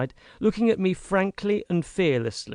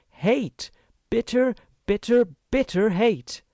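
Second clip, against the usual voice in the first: "Hate" hit higher, each "bitter" pressed harder; emphatic hate; 9 semitones higher than usual. Acted. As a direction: hammer home "bitter hate". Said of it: "by way of adding emphasis".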